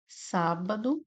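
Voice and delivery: sábado split as sá-ba-do, sá stressed; syllable by syllable. Shown as a demonstration. Teacher splitting sábado into syllables for students. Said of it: "separa dessa forma".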